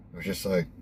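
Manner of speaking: ordinary talk, nothing marked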